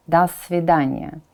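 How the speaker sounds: normal talking voice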